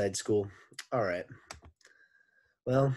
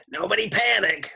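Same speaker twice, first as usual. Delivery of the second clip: high, loud sloshing lady voice; Miss Mush voice; 12 semitones higher, 10 dB louder than usual. Shown as a demonstration. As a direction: redo it as the sloppy lunch lady. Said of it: "let me do Miss Mush's voice".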